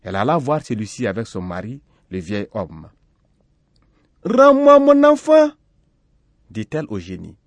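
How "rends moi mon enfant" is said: very high, loud, slow demand; high-pitched, drawn-out mother's plea. A narrator's reading. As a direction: cry out for your child. Mother demands her child back.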